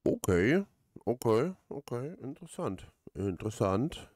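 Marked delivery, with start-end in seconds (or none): none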